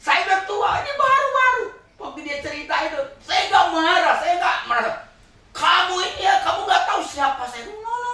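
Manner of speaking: loud, high, laughing sermon story; fiery preaching. Acted. Preacher storytelling at full force.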